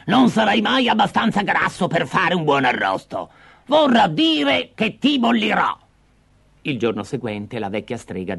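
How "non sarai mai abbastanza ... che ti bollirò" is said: high, grumbling witch voice; fussy hag changing plans; cross witch. Acted. The witch tells Hansel she will boil him.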